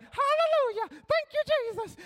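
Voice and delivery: very high, hooting praise; excited churchgoing grandma. Retold or imitated. Grandma in the pew at church, ecstatic praise. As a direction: squeal it out as an ecstatic worshipper. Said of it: "just sitting there all excited".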